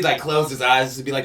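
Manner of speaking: usual speaking voice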